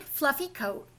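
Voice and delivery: plain reading voice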